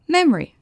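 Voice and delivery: "Memory" starting high on MEM, then falling; stress on first syllable. Shown as a demonstration. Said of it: "L'accent tonique est sur la première". (« Memory » accentué sur la première syllabe)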